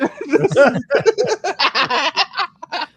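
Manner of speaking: real high laugh; high burst of laughter. Real, not acted. Laughing at the impression, high laughter. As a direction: laugh out loud, high and free.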